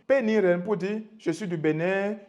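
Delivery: normal talking voice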